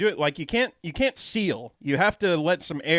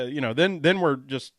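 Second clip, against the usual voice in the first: ordinary talk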